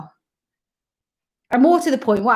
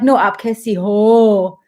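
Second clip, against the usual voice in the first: teasing correction; 4 dB louder, much slower than usual. Retold or imitated. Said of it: "And he'd be like".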